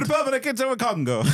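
high-pitched put-on African accent; playfully exaggerated African accent; mock African accent. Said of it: "I'm gonna do the African accent".

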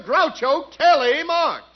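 ordinary delivery

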